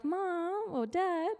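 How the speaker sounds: put-on whiny younger child. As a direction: draw it out like a sulky kid. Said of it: "been like the younger child".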